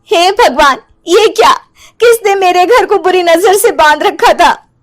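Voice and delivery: aghast at the swollen doll; shocked housewife. Acted. (चौंकी हुई ऊँची आवाज़ में घबराई औरत)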